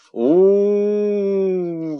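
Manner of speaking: very high, loud long howl; enraged serpent howl; royal howl. Acted. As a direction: howl in outrage. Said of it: "завыл царь".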